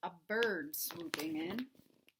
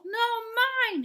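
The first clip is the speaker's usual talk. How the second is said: much higher and loud, a squirrel's squeal of protest; hollered; 13 semitones higher, 9 dB louder than usual. A narrator's reading. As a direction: holler it back, high and loud. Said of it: "hollered Bruce".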